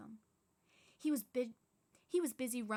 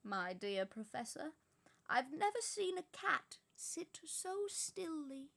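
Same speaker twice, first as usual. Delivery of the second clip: slow, higher gentle wizard voice; kindly old wizard; 6 semitones higher, slower than usual. Acted. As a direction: tease her kindly, taking your time. Dumbledore's voice.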